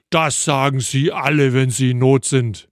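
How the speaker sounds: lightly voiced booming voice from above; mildly resounding storyteller's God voice. A narrator's reading. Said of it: "eine laute Stimme durch den Canyon dröhnte".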